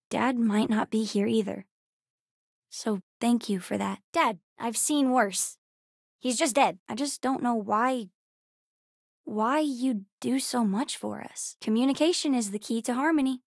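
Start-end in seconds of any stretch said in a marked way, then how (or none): none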